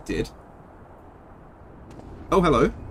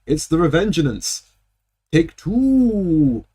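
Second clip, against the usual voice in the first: gloating returning dragon; 2 semitones higher, much slower than usual. Pretend.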